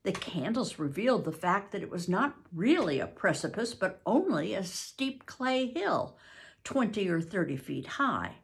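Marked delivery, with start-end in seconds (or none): none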